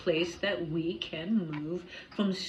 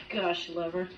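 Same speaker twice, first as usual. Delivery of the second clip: ordinary delivery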